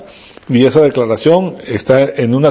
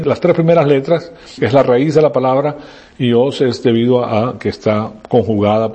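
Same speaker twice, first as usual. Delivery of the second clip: usual speaking voice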